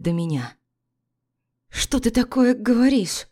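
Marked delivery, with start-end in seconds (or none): 1.7-3.3 higher, louder, shaken question; a wife stunned and shaking at his accusation; trembling voice. A narrator's reading. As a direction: ask it with a trembling, frightened voice. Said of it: "спрашиваю дрожащим голосом".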